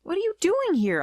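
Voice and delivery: high, startled outcry; shocked cry. Acted. Narrator's sister appears in disguise, high shocked cry. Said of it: "I cried".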